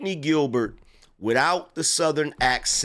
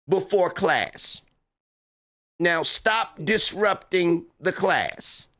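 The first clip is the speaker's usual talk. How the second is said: disrespected school teacher; 3 semitones higher than usual. Acted. Teacher telling unruly students to sit down, mock exasperation. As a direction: play a teacher failing to control the class. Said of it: "you're a school teacher whose students don't respect her".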